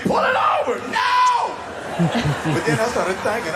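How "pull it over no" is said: high, loud furious bark; angry cop shout. Retold or imitated. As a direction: yell it like an enraged cop. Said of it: "you can hear the cop getting mad".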